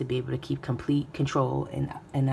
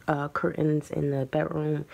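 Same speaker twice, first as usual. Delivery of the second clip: ordinary talk, nothing marked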